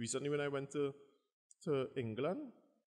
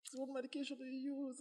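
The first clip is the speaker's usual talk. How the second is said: mock curious foreigner; 13 semitones higher, 5 dB quieter than usual. Questioner voice.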